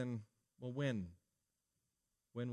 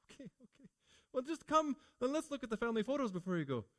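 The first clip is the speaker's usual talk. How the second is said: tearful clingy mother; 9 semitones higher, faster than usual. Retold or imitated. Mother guilting her child into staying for tea, mock-weepy and high. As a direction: play the mother pretending to cry so the child stays.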